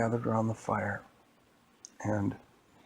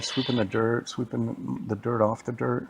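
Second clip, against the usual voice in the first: plain reading voice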